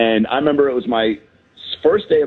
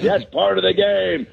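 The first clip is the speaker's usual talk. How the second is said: barking gym coach; 7 semitones higher than usual. Retold or imitated. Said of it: "he's like".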